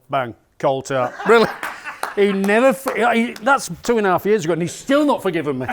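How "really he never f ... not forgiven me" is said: higher and louder, excited; high, loud, amused. Real, not acted. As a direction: wind up the outrage with a laugh. Laughing, amused outrage.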